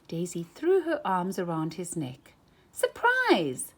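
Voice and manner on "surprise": high, loud surprise; excited cry. A narrator's reading. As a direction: burst in with a cheerful surprise. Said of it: "she cried".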